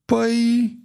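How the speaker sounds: high, drawn-out hesitant filler; mildly flustered hesitation; stammering. A narrator's reading. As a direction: stall on a high note, unsure what to say. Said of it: "bâigui Croas".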